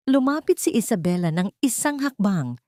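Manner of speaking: ordinary delivery, nothing marked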